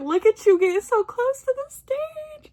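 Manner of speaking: very high-pitched squeal of pride; proud gushing. Retold or imitated. Fan to friend in the second row, proud and gushing. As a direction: gush at a friend in a high, excited voice. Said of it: "I was so proud of her".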